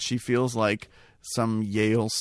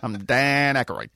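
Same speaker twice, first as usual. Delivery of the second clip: put-on character voice, a little raised; Dan Aykroyd; 3 semitones higher, 5 dB louder than usual. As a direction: do a comic movie-star impression, pushed louder on the first line. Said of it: "I do a mean Aykroyd".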